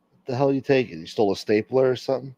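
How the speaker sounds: usual speaking voice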